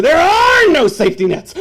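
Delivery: high, loud frantic shouting; screaming inner voice. Retold or imitated. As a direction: yell to wake him. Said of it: "is screaming to you".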